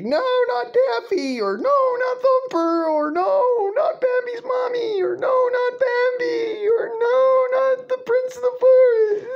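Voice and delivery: very high, crying falsetto pleas; whiny pleading. Pretend. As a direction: beg like a weepy child for each cartoon animal. Mock pleading for cartoon animals.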